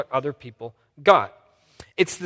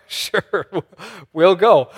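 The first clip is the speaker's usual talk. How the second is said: laughing agreement; 3 semitones higher, 8 dB louder, much slower than usual. Retold or imitated. Workers gladly accepting the job.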